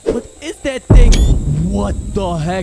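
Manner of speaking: loud, high cry of alarm; frightened shout. Real, not acted. Gamer spotting a thing in the dark basement, frightened shout. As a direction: yell out at a horrifying sight.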